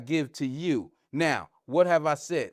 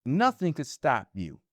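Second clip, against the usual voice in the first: normal talking voice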